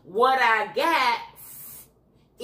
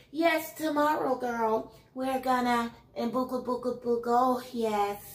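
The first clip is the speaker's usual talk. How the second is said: mock fake proper voice; 5 dB quieter than usual. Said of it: "That's the way you talk".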